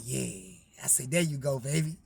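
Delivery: low, soft, tender reassurance. Retold or imitated. Nephew soothing his dying cousin, soft and tender.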